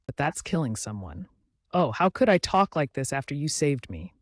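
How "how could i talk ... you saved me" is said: ordinary delivery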